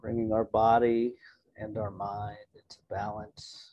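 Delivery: soft-spoken relaxation voice; guided meditation. Real, not acted. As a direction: settle listeners gently, leaving room to breathe. Leads an opening meditation.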